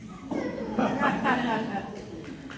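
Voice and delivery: a soft, real laugh that slips out mid-scene; breaks out laughing. Real, not acted. Actor breaking during the lovers' scene, soft real laugh. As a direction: laugh quietly at the line.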